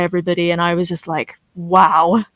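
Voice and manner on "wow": ordinary delivery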